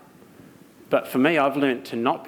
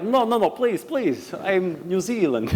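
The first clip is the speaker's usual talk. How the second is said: put-on high, pleading Russian accent; hammed-up Russian-accented begging; mock Russian accent; 8 semitones higher, slower than usual. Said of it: "I just learned the accent".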